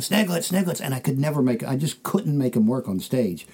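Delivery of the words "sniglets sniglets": mock crowd yell. Club audience demanding his signature bit, mild mock yell. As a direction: mimic an audience calling out, held back. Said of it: "they'd start yelling".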